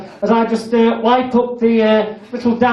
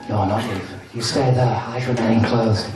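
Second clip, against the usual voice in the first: low, soft instruction; hypnotist's voice; 12 semitones lower, 4 dB quieter than usual. Acted. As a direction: murmur it close to the mic.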